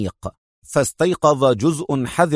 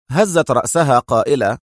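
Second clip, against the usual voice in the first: ordinary delivery, nothing marked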